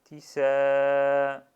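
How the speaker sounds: tisa said loud with a long final a; drawn-out tisa. Shown as a demonstration. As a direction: stretch the a in tisa. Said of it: "C'est la même chose que là". (tisa avec un a prolongé et fort)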